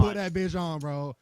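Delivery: loud high shout. Real, not acted. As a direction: yell it across the room. Egging on player across room.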